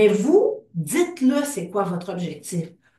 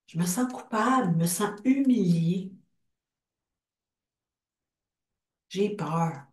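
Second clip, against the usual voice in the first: guided tapping script. Acted. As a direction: lead the group through each feeling, line by line. Leads an EFT tapping script.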